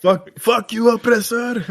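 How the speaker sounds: mock defiant Pole. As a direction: squeal defiance at the oppressor in parody. Acting out defiant Pole in meme.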